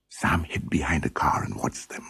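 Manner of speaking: whispered, secretive; hushed narration. A narrator's reading. Narrator whispering as Sam hides and watches the gang. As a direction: whisper it like you're hiding too.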